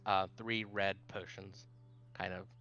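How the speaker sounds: usual speaking voice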